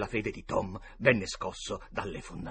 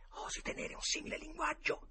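high, soft stage whisper; feed your friend the forgotten line; whispered prompt; 9 dB quieter than usual. A narrator's reading. (suggerimento sussurrato)

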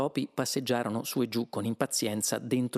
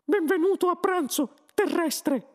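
high, loud squeaky little voice; tiny voice; 5 dB louder than usual. A narrator's reading. Mouse host welcomes the Earthman to lunch, tiny high voice. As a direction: pipe it like a tiny creature. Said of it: "Una vocina disse".

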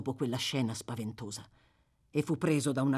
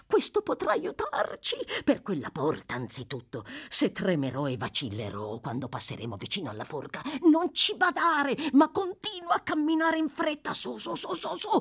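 put-on high, eager, frantic old man; old man urging on; 11 semitones higher than usual. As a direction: egg him on, half-mad with hope.

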